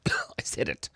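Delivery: hushed, under the breath; whispered aside. Real, not acted. To the chat after a tongue twister, whispering.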